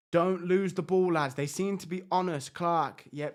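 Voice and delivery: normal talking voice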